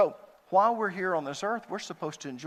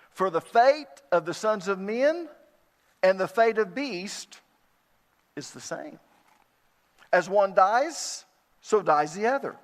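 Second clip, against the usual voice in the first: raised, ringing reading of the verses; preacher proclaiming scripture to the congregation, raised and ringing; emphatic scripture reading; 4 semitones higher, 7 dB louder than usual. Acted. Preacher reads the verses to his flock, raised and emphatic.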